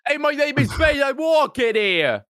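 high, shouted hammy impression; Bane. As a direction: shout it as a comic movie tough guy. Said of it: "He's got the mask on and talks like this".

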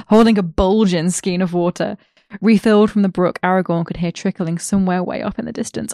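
ordinary talk, nothing marked

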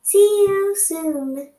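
cheery goodbye. Real, not acted. Signing off podcast.

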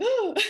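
high, loud gasp and ooh; gasp, then a sudden high-pitched "ooh"; re-enacted gasping "ooh". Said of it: "I was like".

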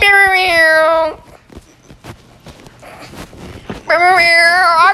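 slow brr noise. Pretend. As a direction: draw out brr brr brr playfully. Silly noise, slowed.

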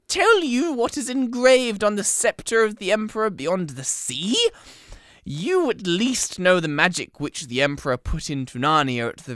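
high, loud and shrill; shrill, loud villain monologue; shrill witch voice. Acted. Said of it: "her voice growing suddenly shriller".

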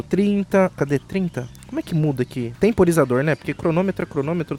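normal talking voice

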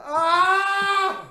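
long high loud scream; mock scream. Answering the dark joke with a long mock scream. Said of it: "Bueno, grita, pues, grita".

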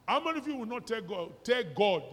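ordinary talk, nothing marked

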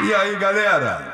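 greeting with heavy echo; echoed hello to the crowd. Real, not acted. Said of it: "same greeting, echoed vs plain".

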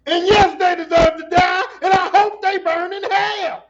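high, loud furious shout; shouted movie line. Retold or imitated. Quoting the iconic line from the film, a furious shout. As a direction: yell it like the courtroom verdict.

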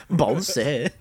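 laughing gibberish answer. Pretend. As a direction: giggle out the word. Call and response laugh.

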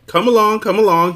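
calling loudly from a dark cave; loud impatient call into the cave; shouting. A narrator's reading. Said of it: "he shouted from the dark hole".

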